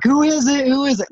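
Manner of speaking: mock announcer working the audience; hyped-up host. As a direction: pump up the crowd like an emcee. Host hyping the crowd.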